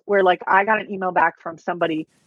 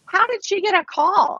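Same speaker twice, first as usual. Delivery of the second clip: mock doubter; 11 semitones higher than usual. Mocking doubters' question.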